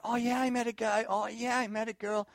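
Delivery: high-pitched imitation of smitten gushing; mock giddy excitement. Student telling the pastor of a new crush, mock giddy. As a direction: gush it in a high put-on voice.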